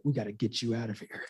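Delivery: lightly acted secretive undertone; confidential aside. Gordon to Batman once the door closes, hushed. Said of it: "as soon as they close the door, he's like".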